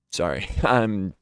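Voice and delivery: choked little laugh through tears; laughing it off while tearing up; teary laugh. Real, not acted. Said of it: "getting a little teary".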